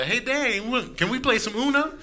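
put-on a child asking eagerly; son asking. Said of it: "my son coming up to me like".

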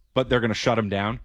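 normal talking voice

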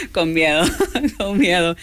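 nervous laugh looking back on enlisting. Real, not acted. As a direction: laugh through the words, then a high burst. Laughing recalling fear of enlisting.

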